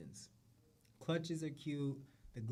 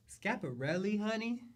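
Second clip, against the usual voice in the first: higher, louder showcase call; excited; 8 semitones higher, 4 dB louder than usual. Real, not acted. Host hypes Schiaparelli to viewers, excited and higher. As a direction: announce it like a runway reveal.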